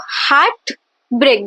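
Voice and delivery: "heart break" slowly, both words stressed with a gap between; two equal stresses. Shown as a demonstration. Teacher giving an example of spondee, two separate stresses. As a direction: pause after "heart", then stress "break" just as much. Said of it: "there are two stressed syllable only".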